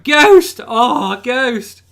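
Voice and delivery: high, loud squeal of delight; imitating an excited chat squealing at the dog; mock excited chat. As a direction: squeal his name like an excited fan. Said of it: "everyone's just going".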